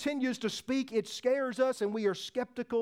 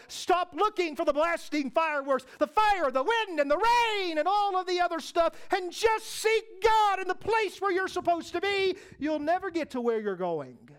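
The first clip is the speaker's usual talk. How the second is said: high, driving pulpit exhortation; full-force preaching; 6 semitones higher than usual. Real, not acted. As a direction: build the list with raised pitch and force.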